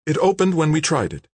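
high, mildly frightened woman's voice; shaky. A narrator's reading. Kimberly answers the gunman, shaky and high. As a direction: answer nervously, pitched high. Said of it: "Kimberly said shakily".

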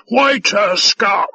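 slow, loud, high bellow; drawn-out, domineering shout. A narrator's reading. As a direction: bellow imperiously at a lowly servant. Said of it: "he bellowed".

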